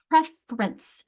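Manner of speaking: "preference" said as pref-rence, two syllables. Shown as a demonstration. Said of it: "It becomes two syllables".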